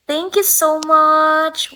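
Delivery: loud, high, stretched-out gratitude; excited, slow, emphatic thanks; gushing thanks. Real, not acted. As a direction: draw out each word in delight. Thanking viewer for a gift.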